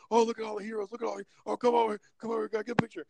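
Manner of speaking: high, gushing show-off voice; flamboyant Batman fan. Retold or imitated. Batman fan calling heroes over for pictures, high and gushing. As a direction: hype up the heroes like a flamboyant loudmouth. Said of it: "and he was a loudmouth, like, super loud".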